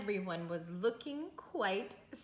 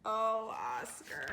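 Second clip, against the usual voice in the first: ordinary delivery, nothing marked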